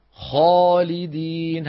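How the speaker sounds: heavy alif after kha in خالدين; slow demo. Shown as a demonstration. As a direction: draw out خالدين slowly with a full heavy alif. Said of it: "هذا مستعلي".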